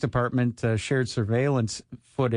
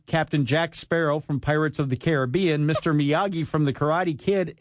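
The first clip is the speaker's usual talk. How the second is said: ordinary talk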